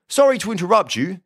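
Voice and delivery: high-pitched, polite village policeman; apologetic officer. A narrator's reading. Officer interrupts the visit, apologetic. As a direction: break in politely, pitched well up.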